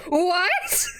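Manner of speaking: horrified. Acted. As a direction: blurt out in horror. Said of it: "He looks horrified again and goes".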